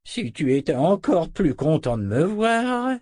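put-on high, drawn-out old man's complaint; grumbling old wizard. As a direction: grumble slowly in a put-on elder's voice. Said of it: "marmonna le sorcier". (vieux sorcier ronchon)

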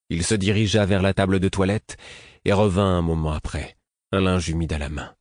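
plain reading voice

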